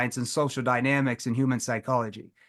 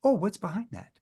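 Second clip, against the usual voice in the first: playful curious; 4 semitones higher than usual. Shown as a demonstration. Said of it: "hear my tone".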